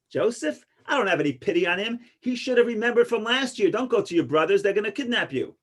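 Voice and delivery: put-on old woman's scolding. Old woman's punchline in joke.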